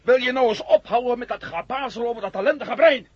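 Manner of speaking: high, loud exasperated yell; angry outburst. Acted. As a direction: cut him off furiously. Matt fed up, snaps. (woedend en luid uitgevallen)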